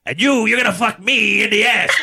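high, hammed-up stand-up delivery; mock-brash comic bit; Eddie Murphy. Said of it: "listening to Eddie Murphy Raw".

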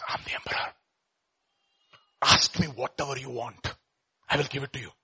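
whispered reveal; hushed reveal. Acted. Emperor reveals himself to beggar.

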